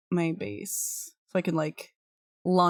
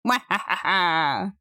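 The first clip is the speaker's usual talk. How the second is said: sneering panto villain; slower than usual. Pretend. Gloating villain laugh at doors.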